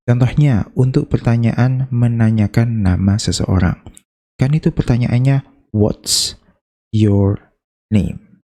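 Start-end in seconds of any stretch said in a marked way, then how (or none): 5.7-8.4 slow "What's... your... name" with clear gaps; each word of "What's your name" alone as one syllable. Shown as a demonstration. Teacher counting syllables for beginners, word by word. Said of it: "Jadi ada tiga suku kata".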